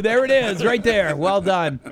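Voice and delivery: loud, high, amused approval; delighted cheering. Real, not acted. Host applauds colleague's accent attempt, loud delighted praise.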